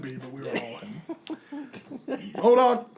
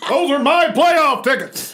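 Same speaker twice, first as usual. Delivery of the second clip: raging yell, louder and higher; angry shout; 6 semitones higher, 5 dB louder than usual. Acted. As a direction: bellow it out in fury. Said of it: "in a rage".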